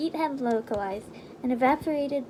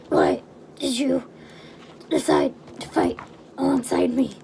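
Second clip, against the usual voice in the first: gruff soldier voice; 4 dB louder than usual. Acted. The dying soldier asks.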